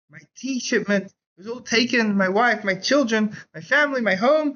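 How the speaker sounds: hammed-up mild anguish; despairing lament. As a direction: cry out over everything lost. Ruined merchant waking from faint.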